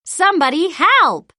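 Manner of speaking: yell for help. A narrator's reading. Pete stuck in the web yells for help. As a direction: shout it out, stuck and panicking. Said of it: "yells Pete".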